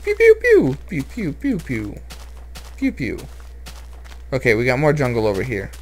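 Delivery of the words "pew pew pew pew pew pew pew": high pew-pew zaps fading away; laser pew sounds. Pretend. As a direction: fire off toy blaster noises.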